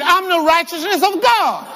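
high, full-conviction declaration; like you mean it. Shown as a demonstration. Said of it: "Say it like you mean it".

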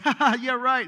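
loud high mocking laugh; teen scoffing at classmate's hell warning, laughing. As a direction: laugh it off dismissively.

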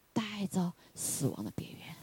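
hushed and slow at the edge of death; a sudden whispered aside in the sermon. Real, not acted. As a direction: breathe the words softly. Preacher drops to hushed tone.

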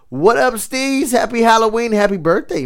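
happy host hyping up his partner; excited greeting. Real, not acted. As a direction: call out the greeting like a radio host.

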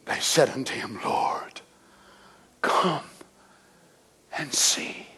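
hushed, breathy reading of the verse; whispered scripture. Acted. Preacher reading the tomb scene to the congregation in a whisper. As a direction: let the words fall to a whisper.

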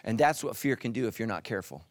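ordinary delivery

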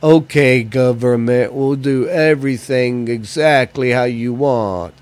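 slow, meek mock compliance, a touch of a put-on voice; lightly mocking obedient citizen; mock sheep. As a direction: play a docile sheep obeying the government. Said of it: "acting like sheep and going".

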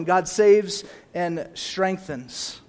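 slow, stressed saves; slow emphasis. Shown as a demonstration. Pastor stating the first point slowly. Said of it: "so the first one".

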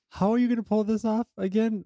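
high incredulous question; mock disbelief. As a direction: sound baffled at the plan. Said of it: "I was like".

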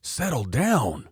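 a stern teacher, slow and high; slowly calm an outburst, like a teacher; instructor scolding. Retold or imitated. Instructor scolding him in class.

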